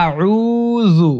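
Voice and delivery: আউযু said slowly, vowels stretched; slow drawn-out Auzu. Shown as a demonstration. Teacher drawing out আউযু for students. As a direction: model আউযু long and slow. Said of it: "আমার সাথে পড়বেন".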